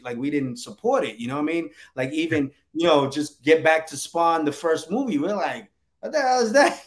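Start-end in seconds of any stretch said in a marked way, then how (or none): 6.0-6.8 much higher, baffled outburst; mock disbelief. Retelling fans' reaction to the first Spawn movie, mock disbelief. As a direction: imitate an appalled, baffled viewer.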